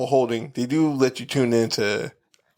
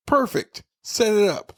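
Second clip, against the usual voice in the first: eager delight; 10 semitones higher, slower than usual. Retold or imitated. Eagerly accepting the appointment.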